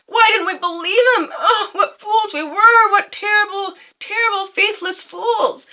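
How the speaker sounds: high, loud hammed-up sobbing lament; mock wailing grief. The crowd mock-wailing over Faithful John turned to stone. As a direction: wail it like an over-the-top mourner. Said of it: "everyone was crying".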